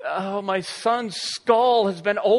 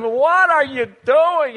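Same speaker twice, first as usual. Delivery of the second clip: loud, slow, higher alarmed yell; alarmed parent; 8 semitones higher, 10 dB louder, slower than usual. Retold or imitated. Father calling to son up a tree in alarm. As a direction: call up in alarm at him. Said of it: "and I'm".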